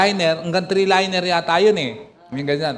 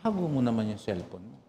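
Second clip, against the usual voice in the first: lower, much softer hushed request; retold whisper; 8 semitones lower, 10 dB quieter than usual. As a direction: say it close and low so others won't hear. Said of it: "binulungan ako nung nagbigay".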